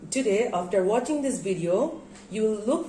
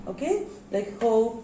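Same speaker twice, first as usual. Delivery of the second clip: normal talking voice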